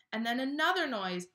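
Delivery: put-on lively read-aloud to children; animated picture-book narration; storybook reading. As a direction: read it like bedtime story time.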